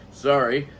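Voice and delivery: sorry said through a chuckle; laugh, then a quick sheepish sorry; laughing apology. Real, not acted. Reviewer apologizing to viewers for his rant, laughing.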